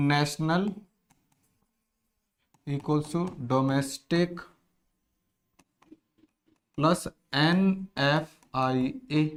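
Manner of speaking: very slow, word by word; slow formula dictation. Shown as a demonstration.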